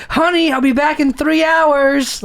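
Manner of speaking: high, slow sing-song farewell; hammed-up high parental send-off; mock parent calling out. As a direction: call sweetly to a child left at home.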